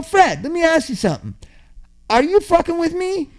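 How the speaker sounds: high, insistent challenge; retold confrontation. Driver challenging his deaf passenger on the drive back, retold indignant questioning. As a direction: confront him as if you suspect a trick. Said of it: "and I go".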